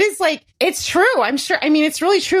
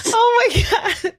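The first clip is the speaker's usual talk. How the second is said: laughing disbelief; 13 semitones higher, slower than usual. Real, not acted. Overwhelmed by guest's joke.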